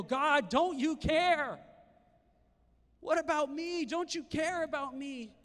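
high, loud, slow pleading wail; desperate cry to God. Retold or imitated. Disciples cry out in storm.

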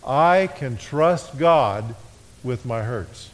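drawn out, fading on hurts; very slow, emphatic. Shown as a demonstration. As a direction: lead the repeat slowly. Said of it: "One more time".

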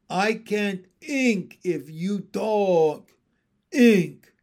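slow, loud frog voice; insistent frog husband. Acted. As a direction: drag out each word, punching the last. Pin insists he is thinking.

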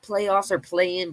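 ordinary delivery

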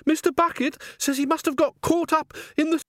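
a jittery high voice from the crowd; high bystander voice. Acted. Bystander speaks.

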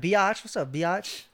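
biatch drawn out as bee-ahtch, playful; casual slang greeting, biatch stretched; slangy biatch. Shown as a demonstration. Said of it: "Different pronunciation".

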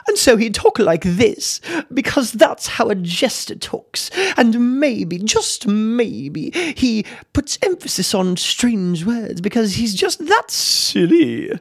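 very high-pitched British court jester; exaggerated silly British. Shown as a demonstration. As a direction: play a silly jester with hammed-up British accent. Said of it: "very exaggerated, silly British".

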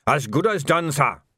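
a sergeant gladly taking the order; happy. A narrator's reading. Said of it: "said Dickens happily".

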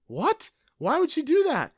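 surprised. Acted. Shocked at the president.

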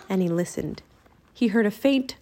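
ordinary delivery, nothing marked

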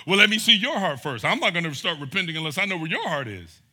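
loud, indignant mock demand; mock demanding offender. Offender demands the victim's heart first, loud mock indignation. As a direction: ham up a self-righteous offender. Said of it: "So you don't get to go".

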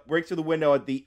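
usual speaking voice

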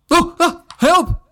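high, loud cry for help; acted panicked yelp; sudden frightened shout. Reader voicing Shasta touched from behind, sudden shout. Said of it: "he shouted suddenly".

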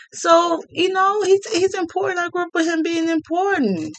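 high, keyed-up fan voice; excited. Real, not acted. Excited about Jordan.

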